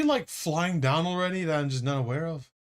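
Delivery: ordinary talk, nothing marked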